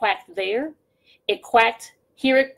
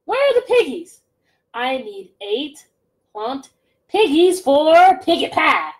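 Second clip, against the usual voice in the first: witch demanding; 5 semitones higher, 5 dB louder than usual. Acted.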